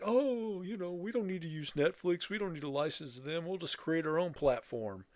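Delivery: slightly hammed-up corporate know-it-alls; imitating streaming companies. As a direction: mimic companies airily brushing off Netflix. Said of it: "they're like".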